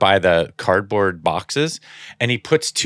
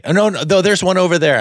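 a higher, eager wife pointing out spots; lightly higher, eager backseat-driver wife; imitating wife; 8 semitones higher than usual. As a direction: play the wife excitedly spotting parking. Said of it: "she's like".

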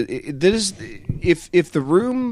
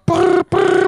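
high ringing brr noise; imitated phone ring; 4 dB louder than usual. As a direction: mimic a phone buzzing.

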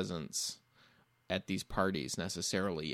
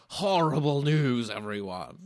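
put-on old professor voice; 5 semitones higher, 6 dB louder than usual. Parody of the professor's catchphrase.

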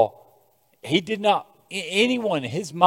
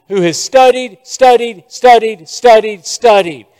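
studied, studied, studied hammered loud and high; stress on studied; 5 semitones higher, 7 dB louder than usual. Acted. Preacher mocking Paul's endless study, loud hammering repeats. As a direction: pound each studied louder.

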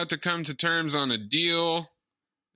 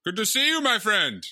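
high put-on greeting voice; 7 semitones higher than usual. Greeting colleague Nando in a high put-on character voice. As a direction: play a hearty character greeting a friend. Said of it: "That's pretty well done".

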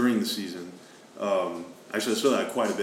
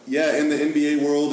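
ordinary delivery

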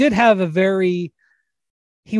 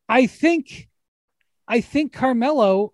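ordinary delivery

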